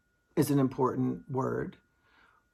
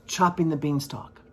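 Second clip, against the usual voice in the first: ordinary delivery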